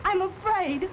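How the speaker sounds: high, tense and scared; frightened. Acted. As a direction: voice rising with fear. Frightened niece confides fear.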